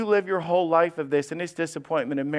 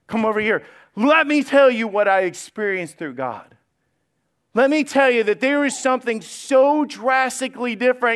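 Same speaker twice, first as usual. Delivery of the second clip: excited; 6 semitones higher, 4 dB louder than usual. Retold or imitated. John voiced, inviting excitedly.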